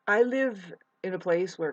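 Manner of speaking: normal talking voice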